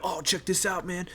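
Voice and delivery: put-on high, excited kid slang; lightly mocking his kids' hype voice. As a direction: mimic teenage kids showing off a game. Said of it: "talking how my kids talk".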